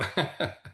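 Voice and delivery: brief, high little laugh; amused chuckle. Real, not acted. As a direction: chuckle after gently teasing the host. Laughs after teasing the host.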